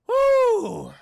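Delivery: high, loud drawn-out woo; loud whoop. Real, not acted. Host breaking off between topics, loud high whoop. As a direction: let out a long whoop that drops at the end.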